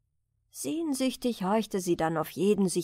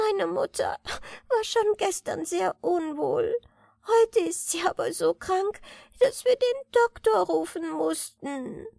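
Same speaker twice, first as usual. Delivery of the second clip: put-on sobbing girl; 9 semitones higher than usual. A narrator's reading. Girl telling the old lady her mother is ill, sobbing. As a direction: blurt it out through tears. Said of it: "schluchzte Walli".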